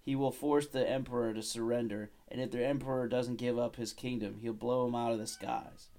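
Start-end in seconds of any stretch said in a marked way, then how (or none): none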